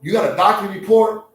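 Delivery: a lion casually asking a zebra, mock-polite; chatty predator checking up on prey. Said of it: "I don't think a lion says".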